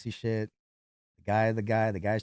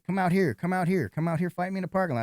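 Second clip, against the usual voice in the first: higher-pitched mock fighting talk; retold angry challenge; 8 semitones higher than usual. Bouncer imitating a man picking a fight, mildly heated challenge. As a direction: imitate a riled man calling someone out.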